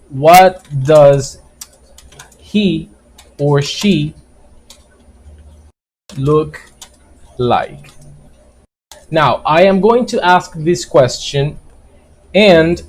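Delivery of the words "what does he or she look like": each word set apart by long pauses; slow, word by word. Shown as a demonstration. Teacher to students, dragging the question out word by word. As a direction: spell the question out slowly for learners. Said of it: "slow spaced demo of question".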